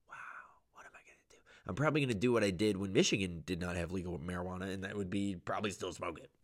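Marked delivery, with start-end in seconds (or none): 0.1-1.4 mock-worried whisper. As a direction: breathe it, barely voiced. Mock worry aside.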